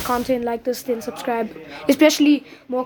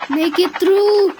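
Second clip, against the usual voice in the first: desperate plea; 7 semitones higher, 4 dB louder, slower than usual. Real, not acted. Gamer pleading in closing storm.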